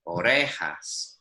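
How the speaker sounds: "orejas" slowly, the stress on "re"; stress on second last. Shown as a demonstration. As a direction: lean on the "re" of "orejas". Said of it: "the stress on most words comes on the second last syllable".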